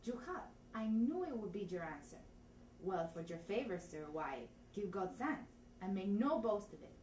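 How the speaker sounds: put-on lines in a thick Spanish accent; measured Spanish-accented delivery. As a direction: play the constable with a comic Spanish accent. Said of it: "try it in Spanish".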